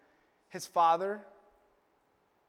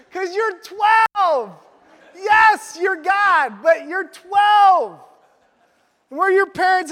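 higher, louder, very slow shouting; exasperated shout; 9 semitones higher, 11 dB louder, much slower than usual. Acted. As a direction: yell it like a fed-up parent. Exasperated parent shouting at son.

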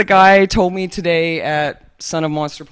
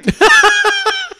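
loud high laugh; 7 dB louder than usual. Real, not acted. Big laugh after joke.

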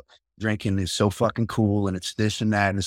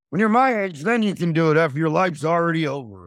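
higher-pitched mock parental scolding; imitating mad dad; 6 semitones higher than usual. Drinking dad answering his son back, mad. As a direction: play the dad laying down the law, pitched up. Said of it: "the drinking dad gets mad".

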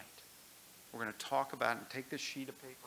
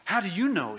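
loud, high, startled question; boy Shasta, startled; 8 semitones higher, 15 dB louder than usual. Acted. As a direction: blurt it out in disbelief. Shasta challenges the voice.

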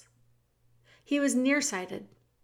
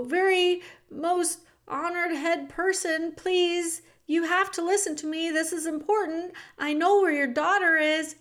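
pleading mouse; 7 semitones higher, 4 dB louder than usual. Acted. The mouse pleads with the king to hear him, high and earnest. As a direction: beg him to listen.

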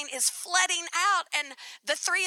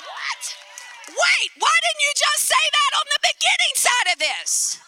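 high, loud disbelieving laugh; shocked, laughing outburst; incredulous; 11 semitones higher, 5 dB louder than usual. Real, not acted. Shocked reaction to upgrade offer.

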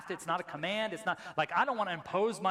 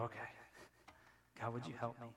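quiet prayer; 5 semitones lower, 13 dB quieter, much faster than usual. Retold or imitated. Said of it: "we need to go".

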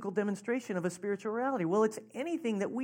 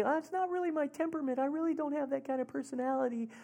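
high, light mock protest; reluctant objection; 6 semitones higher, 6 dB quieter, faster than usual. Congregant excusing themselves from expressive worship, high reluctant objection. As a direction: make a mild excuse in a raised voice.

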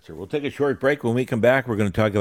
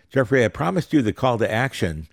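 ordinary talk

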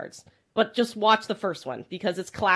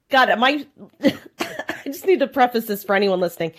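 ordinary talk, nothing marked

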